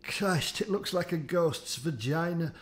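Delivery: ordinary delivery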